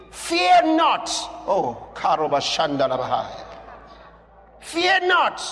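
loud, high shouted proclamation; preaching at full force. Acted. Preacher shouting Jesus' words to John, loud and high. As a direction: thunder it out to the church.

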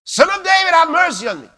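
high, loud desperate cry of "Son of David"; blind man crying out. Retold or imitated. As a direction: call out for mercy from the roadside. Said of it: "A blind man is crying out".

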